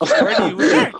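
high, loud, laughing demand; real exasperation breaking into laughter; exasperated, laughing. Real, not acted. Repeats question to misunderstanding friend.

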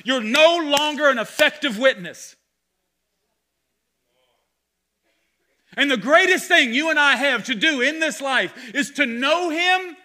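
loud, driving sermon delivery; fiery pulpit preaching, building and pressing; full-force preaching. Acted. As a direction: preach it hard to the congregation.

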